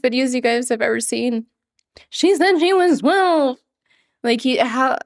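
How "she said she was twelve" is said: high, slow mimic; imitating how he talks. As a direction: say it the way he really talks. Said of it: "he really talks like that".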